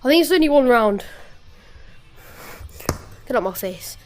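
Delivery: ordinary talk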